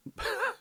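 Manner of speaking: brief high, soft laugh; short laugh. Real, not acted. Shrugging off people who dislike him, a short soft laugh. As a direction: let out a quick high chuckle.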